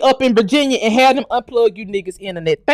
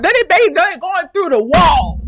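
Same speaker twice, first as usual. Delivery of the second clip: high, loud, slow stammering yell; incredulous shout; 6 semitones higher, 6 dB louder, slower than usual. Real, not acted. Picturing mercenaries breaking mud walls, incredulous shout. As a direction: shout it in disbelief.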